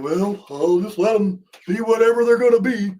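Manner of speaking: lightly hammed-up indifferent official; mock dismissive. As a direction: mimic a leader who can't be bothered. Mocking the governor's passive stance.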